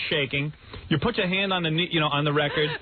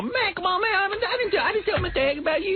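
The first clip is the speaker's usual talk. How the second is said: high, hammed-up street slang voice; put-on pleading in slang; imitating teen slang. Showing how he used to talk, pleading not to be hit. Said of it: "I can't even do it no more".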